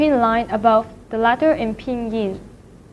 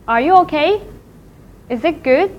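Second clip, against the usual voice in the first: "okay" and "good" each swept upward; rising question pitch; 4 semitones higher than usual. Shown as a demonstration. Teacher giving English example of second tone, rising questions. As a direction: ask it with a clear rise on "okay" and "good". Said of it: "okay and good is in the same pitch with the second tone".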